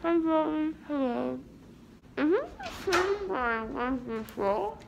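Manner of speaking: ordinary talk, nothing marked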